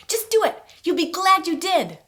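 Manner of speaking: high, loud, chirpy mom voice; perky mom. Acted. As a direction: imitate a perky mother cheering you on. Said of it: "my mom's perky voice".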